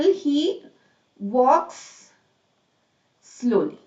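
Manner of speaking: he, walks, slowly, each word apart. Shown as a demonstration. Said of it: "for example".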